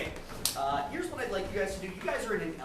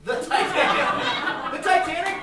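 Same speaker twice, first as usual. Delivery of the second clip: laughing; 10 semitones higher, 11 dB louder, slower than usual. Real, not acted. Host cracks up at suggestion.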